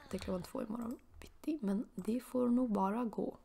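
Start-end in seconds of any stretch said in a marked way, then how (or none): none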